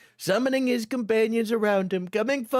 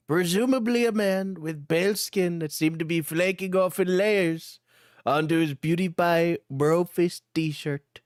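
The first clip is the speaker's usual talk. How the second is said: ordinary delivery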